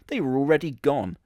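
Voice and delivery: normal talking voice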